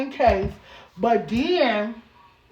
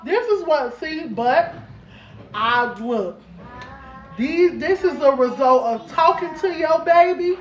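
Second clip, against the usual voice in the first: usual speaking voice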